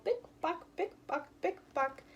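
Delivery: bright high heel sounds, bick buck; playful heel-click sounds for children. Retold or imitated. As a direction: tap out the heels in a light sing-song. Imitating high heels clicking.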